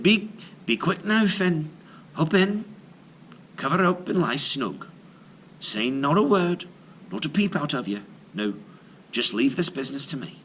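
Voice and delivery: brisk Irish wife hurrying her husband; Una, urgent. Acted. Wife urging Finn into the cradle, hurried. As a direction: hurry him along, a touch anxious.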